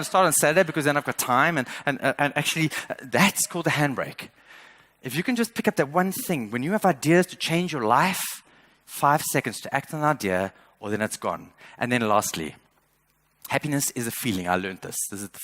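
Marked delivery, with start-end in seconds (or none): none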